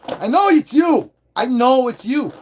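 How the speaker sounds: mock accusing yell; playful accusation. Calling out the lone viewer in chat.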